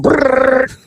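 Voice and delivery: high rolled "brrrr"; imitated motor noise. Teller mimics her frantic handiwork, high buzzing noise. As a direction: buzz it like a little motor.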